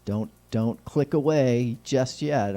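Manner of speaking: usual speaking voice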